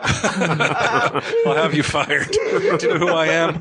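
high laughing spoiled rich snob; drunken posh sneer. Pretend. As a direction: sneer at the butler like a pampered brat. Pampered rich patient bit.

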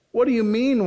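high-pitched mock disbelief; incredulous. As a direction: toss it back in baffled protest. Acted disbelief at the stranger.

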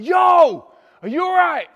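high, loud shout over the band; big hollered check-in; shouted greeting. Retold or imitated. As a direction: yell to be heard over loud music. Calling to her beside loud stage.

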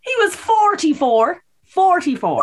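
high, slow stress on forty-four; slow, high emphatic reveal. Real, not acted. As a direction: land the age as a shock. Shocking reveal.

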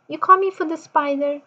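ordinary talk

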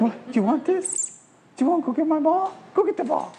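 high, coaxing questions; excited fetch cue; playful dog teasing. Real, not acted. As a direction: tease the dog with the ball.

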